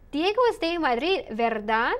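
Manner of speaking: "Diego es de Madrid, ¿verdad?" dropping before the tag, then "verdad" swept upward; rising tag question. Shown as a demonstration. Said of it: "Notice the intonation goes up".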